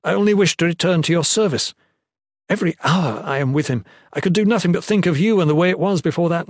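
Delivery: impassioned appeal for a second chance; pleading. A narrator's reading. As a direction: implore with mounting urgency. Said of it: "he pled".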